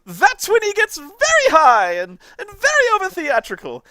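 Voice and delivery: shrill, loud, over-theatrical villain; very high, booming theatrical rant; Turl. Shown as a demonstration. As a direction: shriek it high and hammy, chewing the scenery. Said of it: "he only gets really shrill".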